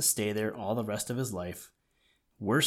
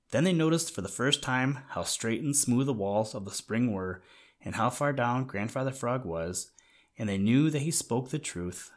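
ordinary delivery, nothing marked

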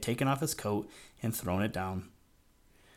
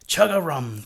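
a big, joyful shout, higher and louder; loud glad croak; 4 semitones higher, 9 dB louder than usual. A narrator's reading. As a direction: boom out a happy frog call, full voice. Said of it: "the loudest, gladdest chug-a-rum".

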